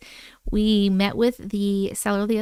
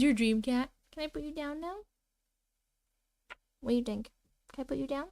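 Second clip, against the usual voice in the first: much softer, cooing pet voice; 9 dB quieter than usual. Real, not acted. Holding the cat up to the camera, soft cooing baby talk. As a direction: murmur sweetly to the cat.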